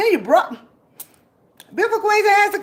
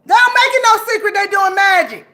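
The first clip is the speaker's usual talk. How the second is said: loud raised ranting shout; angry shout; 4 semitones higher, 7 dB louder than usual. Real, not acted. Raging at enemies doing magic on her, shouting. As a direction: shout it out in fury.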